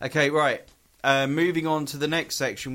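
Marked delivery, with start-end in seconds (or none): none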